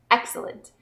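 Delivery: ordinary talk, nothing marked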